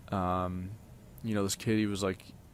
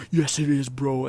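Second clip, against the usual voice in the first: yelling back; 5 semitones higher, 5 dB louder than usual. Retold or imitated. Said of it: "He's like".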